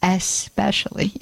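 "especially" measured out as "ess-pecially"; correct "especially", no X. Shown as a demonstration. Host after counting to three, "especially" pronounced with S. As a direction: lead a group saying "especially" with a clear S. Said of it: "Let's say it".

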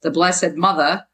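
MOTHER as "mutha", Jersey style; Jersey-accented MOTHER with no R. Shown as a demonstration. Chapter title in mother's words, Jersey accent. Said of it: "M U T H A".

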